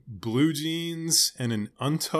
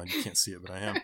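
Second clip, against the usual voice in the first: ordinary delivery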